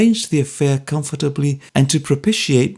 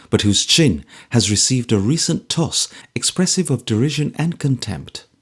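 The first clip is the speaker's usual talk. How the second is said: usual speaking voice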